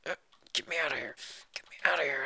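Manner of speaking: hushed, airy begging; whispered plea. Pretend. Trapped player begging out, urgent whisper. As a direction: whisper to be let out. Voices someone trapped in game.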